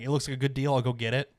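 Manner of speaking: ordinary talk, nothing marked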